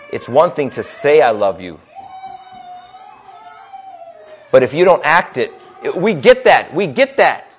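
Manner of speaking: impassioned preacher, rising and pressing; preaching at full force. Acted. Pastor urging the congregation, fervent preaching. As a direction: drive the sermon home to the church.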